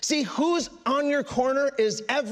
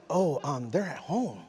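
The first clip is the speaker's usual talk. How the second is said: low, softer, hesitant reply; sheepish kid; 9 semitones lower, 4 dB quieter than usual. Acted. Young newcomer answering the older attendees, sheepish. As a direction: answer meekly, trailing off softer.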